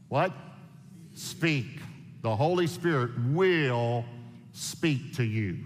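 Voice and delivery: slow, deliberate scripture reading. Acted. Preacher reading the verse aloud, slow and measured. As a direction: lay the verse out word by word.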